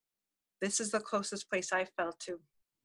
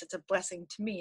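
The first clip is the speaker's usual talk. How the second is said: normal talking voice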